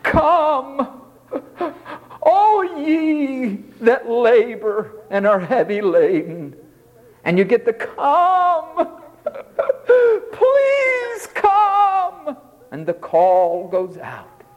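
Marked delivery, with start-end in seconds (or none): 0.0-6.5 a lightly raised, solemn call of come; first reading. Shown as a demonstration. Preacher reading the verse the first way, lightly raised. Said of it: "I'll read it two ways". 7.7-12.4 high, laughing, begging come; pleading read. Shown as a demonstration. Preacher mocking a begging Jesus, high and pleading. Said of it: "I'll read it two ways".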